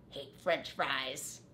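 low, slow rat grumble. Acted. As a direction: grouse about it under his breath. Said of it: "Rat grumbled".

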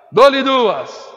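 loud auctioneer call. Real, not acted. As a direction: ring out the going-twice call. Auctioneer's going-twice call. (chamada alta de leiloeiro)

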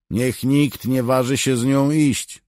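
high, loud command; shouted order. A narrator's reading. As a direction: call the ban out to all the troops. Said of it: "znów zawołał do legionistów". (głośny rozkaz do legionistów)

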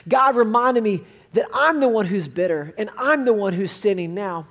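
ordinary talk

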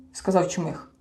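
ordinary delivery

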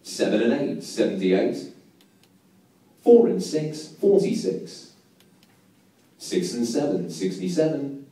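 steady sing-song number calling; bingo caller. Acted.

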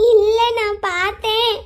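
slow, drawn-out whine of protest; tearful insistence. Acted. Girl insists against his doubts, tearful. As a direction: contradict him tearfully, stretching each word. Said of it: "உன்னை அழ வச்சுட்டு போயிருச்சுல".

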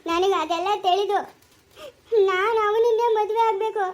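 high, slow, tearful begging; weepy and desperate, slowed down; tearful plea. Acted. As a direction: plead through tears to marry him. Daughter pleads tearfully to marry.

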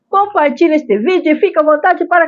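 rising excited cheer. Acted. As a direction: build up to a bubbly squeal. Cheerful sign-off call, rising.

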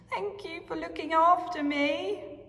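Posy's voice. Acted. Posy voiced in story.

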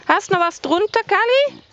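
high sing-song pet voice; pet talk. Acted. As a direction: coo at the cat. Talking to cat, high pet voice.

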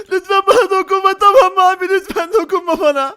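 high, loud, frightened begging; hammed-up plea to be left alone; mock pleading. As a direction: pretend panicked protest. Pleads with armed kidnapper.

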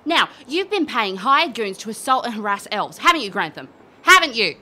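from firm charge up to a loud, high demand; accusing questions rising into a furious yell; building accusation. Acted. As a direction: corner him until you shout it.

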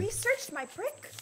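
high, slow indignant question; can't believe it, indignant; outraged disbelief. Real, not acted.

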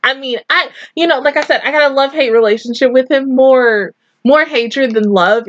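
ordinary delivery

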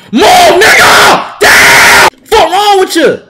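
high, loud, stretched-out yell; furious shout. Real, not acted. Gamer screaming at player who blocked him, furious shout. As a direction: blast him for getting in the way.

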